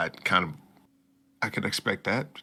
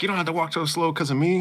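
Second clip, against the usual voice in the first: put-on scolding girl; 9 semitones higher, faster than usual. Voicing the heroine scolding.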